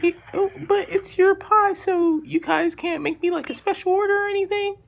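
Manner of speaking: put-on squeaky-high caller voice; high-pitched voice. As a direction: pitch it way up, hesitant on the ums. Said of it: "voice is a little bit high".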